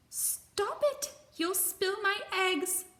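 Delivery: very high, loud bird screech; screeching robin. Acted. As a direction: screech in a panic for the eggs. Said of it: "screeched Robin".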